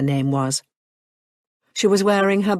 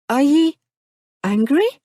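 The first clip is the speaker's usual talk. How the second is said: put-on higher, louder, tentative young woman; careful question; 9 semitones higher, 4 dB louder than usual. A narrator's reading. Marianne carefully asks the man if he's angry. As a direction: ask it gently, probing his face. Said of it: "carefully searching his features".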